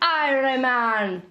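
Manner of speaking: slow, drawn-out Jamaican attempt; playful slow Jamaican; Jamaican accent. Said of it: "I can do the Jamaican one".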